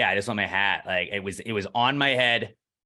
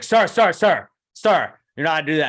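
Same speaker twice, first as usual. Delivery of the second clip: calling out sharp and loud, higher; retold shout; 6 semitones higher, 7 dB louder than usual. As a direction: stop him from across the room, loud. Calling out to stop TSA officer.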